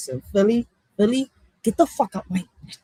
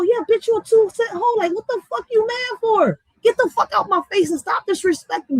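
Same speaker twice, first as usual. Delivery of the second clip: retold clapback; 9 semitones higher than usual. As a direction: throw the insult right back at her. Retold quarrel with tall woman.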